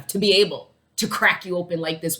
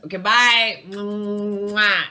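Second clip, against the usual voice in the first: high, loud sing-song farewell; cutesy goodbye; 7 semitones higher, 8 dB louder, much slower than usual. Real, not acted. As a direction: blow the viewers a kiss. Cutesy sign-off to viewers.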